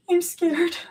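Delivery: high, gasping fear; scared breathless gasp. A narrator's reading. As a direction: gasp it out terrified. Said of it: "she gasped".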